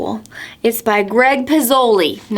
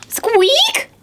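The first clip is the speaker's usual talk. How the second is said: very high little mouse squeak; tiny squeak; 14 semitones higher than usual. A narrator's reading. Storyteller makes the tiny noise Owl hears, high squeak. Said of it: "the noise".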